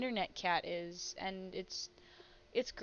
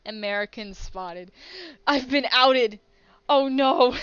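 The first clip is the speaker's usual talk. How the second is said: loud hammed-up alarm at being found out; mock panic; 3 semitones higher, 12 dB louder than usual. Streamer's character, caught as American, bursts out in mock panic. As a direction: yell it like a caught spy.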